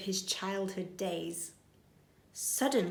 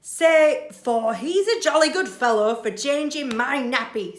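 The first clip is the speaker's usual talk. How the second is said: louder, cheerful coaxing; jolly burglar; 4 semitones higher, 8 dB louder than usual. Acted. Burglar coaxes baby after nappy change, jolly. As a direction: sing his own praises. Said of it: "says Burglar Bill".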